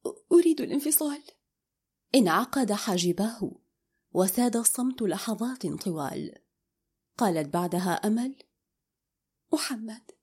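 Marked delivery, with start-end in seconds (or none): 0.1-1.2 faltering, higher and rushed; voice weakening. A narrator's reading. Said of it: "صوتها ضعف رغمًا عنها". 9.5-10.1 hushed name, nearly whispered; soft, hesitant call. A narrator's reading. Distressed wife calls husband softly.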